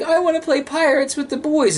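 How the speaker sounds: put-on high little girl voice, pleading; a child's high, wishful voice. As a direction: play the little girl asking to join the boys. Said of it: "She's like".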